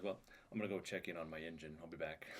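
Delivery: quiet aside. Acted. Character quietly excusing himself to friends.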